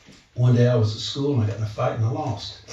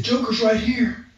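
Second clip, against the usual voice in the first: high, slow, wide-eyed alarm; a nervous man pointing at something unseen; spooked; 11 semitones higher than usual. Retold or imitated. Client telling the preacher the demon is here, high spooked voice.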